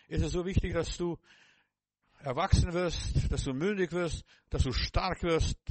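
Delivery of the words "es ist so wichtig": normal talking voice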